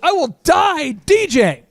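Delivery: high, drawn-out hammed-up vow; melodramatic refusal. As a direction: declare it slowly with mock drama. Retold vow never to quit.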